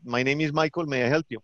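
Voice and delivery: put-on Colombian-accented phone greeting; Colombian call-center agent. Agent greeting an American caller, Colombian accent. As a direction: answer a customer in a Colombian accent. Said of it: "I had a person in a call center".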